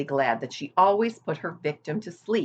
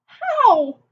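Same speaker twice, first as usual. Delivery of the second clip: much higher, louder; eager question; 9 dB louder than usual. A narrator's reading. Wilbur eagerly asking Charlotte how, much higher and louder. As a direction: blurt it out. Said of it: "asked Wilbur".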